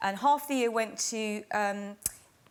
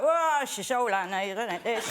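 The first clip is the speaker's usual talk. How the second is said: put-on old lady voice. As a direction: grumble it like a weary old woman. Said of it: "she was just like".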